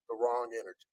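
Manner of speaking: sinks low and soft; dropped low and soft. Acted. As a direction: let it fall to a low hush. Dramatic drop after build.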